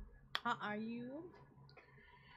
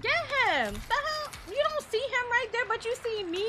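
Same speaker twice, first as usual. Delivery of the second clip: indignant; 9 semitones higher, 4 dB louder than usual. Real, not acted. Indignant at game enemies.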